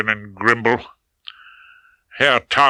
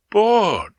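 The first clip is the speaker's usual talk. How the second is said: curiously disappointed; 10 semitones higher than usual. A narrator's reading. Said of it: "She sounded curiously disappointed".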